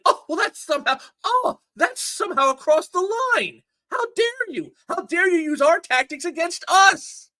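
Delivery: high, whiny mock-offended voice, dragged out at the end; mock outrage. Mocking the trolls with a shrill offended whine. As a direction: ham up a clutch-the-pearls complaint.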